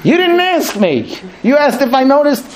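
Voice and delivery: high, mock-pedantic husband; literal husband. Husband twisting his wife's garbage question, mock pedantic. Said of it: "And the husband will say".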